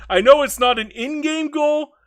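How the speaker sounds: high and loud, eager; excited burst. Real, not acted. Streamer defending his odd goal, loud and high. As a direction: blurt it out with a grin.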